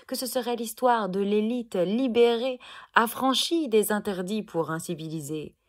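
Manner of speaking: loud, mounting slam outrage; indignant declamation. Acted. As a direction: hurl the words out as an accusation. Poem's outrage at abusive elite peaks. (déclamation indignée et forte)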